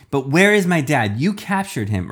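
high, loud confrontation; urgent accusation. Acted. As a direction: demand it of your captor. Child confronts captor.

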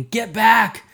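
high, loud urgent warning; warning shout at the boy. A narrator's reading. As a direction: yell it to stop the kid. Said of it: "I warned".

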